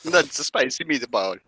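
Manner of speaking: put-on a cheesy Italian accent; bad Italian accent. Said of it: "He's got the worst Italian accent ever".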